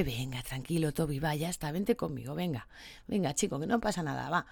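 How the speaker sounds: quiet, gentle coaxing; softly calm a scared dog. Retold or imitated. Owner soothing frightened Toby at the vet, soft and gentle. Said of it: "Le hablarías suave".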